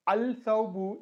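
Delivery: "al-thawbu" with the l sounded; lam pronounced. Shown as a demonstration. Teacher cites the unassimilated example, "al-thawbu" with l sounded. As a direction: keep the l before th in "al-thawbu". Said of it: "instead of al-thawbu".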